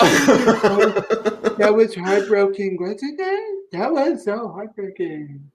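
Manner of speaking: high, slow, drawn-out tipsy gushing; hammed-up slurry sympathy, swooping up high; drunk Pelosi mockery. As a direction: imitate a boozy politician, dragging out every word. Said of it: "completely drunk off of a box wine".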